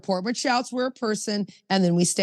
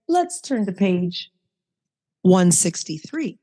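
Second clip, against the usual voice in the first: gently; slower than usual. Retold or imitated. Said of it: "sometimes I'll just gently say".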